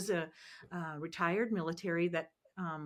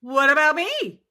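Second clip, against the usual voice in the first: loud, high complaining whine; hammed-up plea to God; mock whine; 6 semitones higher, 14 dB louder than usual. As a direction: whine it up to the heavens. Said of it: "I'm sitting there going".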